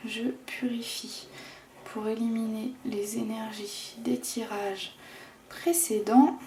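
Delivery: hushed, very soft incantation; whispered ritual. Real, not acted. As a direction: murmur it like a ritual blessing. Purifying ritual before the draw.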